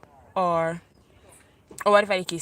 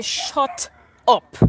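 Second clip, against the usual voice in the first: louder snapped shut up; a sharper, louder second shut up; retold shout; 3 semitones higher, 4 dB louder than usual. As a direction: bark it back at him. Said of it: "I say".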